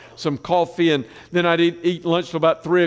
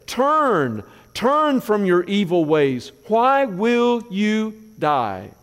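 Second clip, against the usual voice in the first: slow, pleading preacher's cry; fervent preaching, slowed right down; impassioned plea; 3 semitones higher, much slower than usual. Acted. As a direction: call the people to turn, drawing each word out. Pleading call to repentance.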